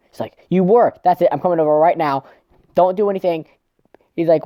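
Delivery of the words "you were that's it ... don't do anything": high, loud mock scolding; acted indignant phone tirade; jealous outburst. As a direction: lay down the law, raised pitch. Voicing Ruka's jealous phone outburst.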